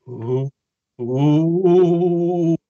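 drawn-out spooky ooh; ghost voice. Pretend. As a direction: moan it like a ghost. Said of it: "my ghost impression".

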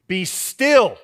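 loud, firm command to the storm. Retold or imitated. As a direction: order the storm to be still. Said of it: "he just commands".

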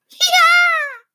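put-on very high, loud toddler crow; happy crowing. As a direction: crow with delight at the splash. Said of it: "crowing happily at the splash".

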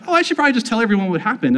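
fast, high, eager retelling; excited rush. Retold or imitated. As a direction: rattle it off in a thrill. Buzzing late at night after the experience.

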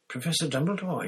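startled, high-pitched call; wide-eyed surprise. A narrator's reading. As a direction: voice jumps up in astonishment. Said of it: "he said, his eyes widening".

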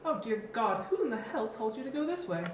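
put-on high-pitched old man voice; chatty old man. Old roadside seller warning travelers, high old voice. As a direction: play him fussy and high.

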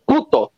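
puto with the first syllable pitched high; stressed puto. Shown as a demonstration. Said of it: "así como lo pronuncian".

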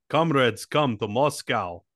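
hammed-up Russian comrade voice; Russian accent. Comic advertising Moscow to alien tourists, mock Russian accent.

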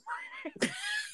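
squeaky burst of laughter; high squeal laugh. Real, not acted. Laughing at memory.

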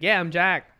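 high, loud imitated answer; retold hearty reply. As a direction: answer up loudly, playing the other guy. Retold upbeat reply to stranger.

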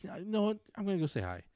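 high, bright decision; sudden resolve. Retold or imitated. Deciding to greet the old teacher, upbeat resolve. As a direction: psych yourself up to say hi.

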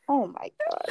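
high exasperated oh my God. Retold or imitated. Girl exasperated at her insecure boyfriend, high and fed up. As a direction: roll your eyes at him. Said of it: "I'm like".

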